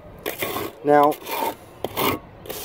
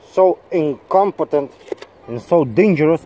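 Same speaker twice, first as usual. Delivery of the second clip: ordinary talk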